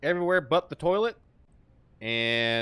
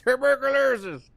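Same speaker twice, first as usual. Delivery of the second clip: put-on Brazilian accent, higher and louder, laughing through it; laughing mock Brazilian accent; 8 semitones higher, 6 dB louder than usual. Still teasing the teammate in a Brazilian accent. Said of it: "You had to say it in my accent".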